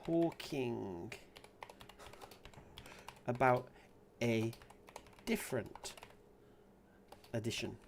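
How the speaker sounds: halting, low, word by word; long gaps between words; composing aloud. Real, not acted.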